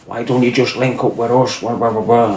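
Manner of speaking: put-on light Irish accent, coaxing; a hint of an Irish gangster accent; Irish kid's pitch. Irish kid from the Jeep, lightly voiced Irish accent. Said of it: "this fat Irish kid jumps out".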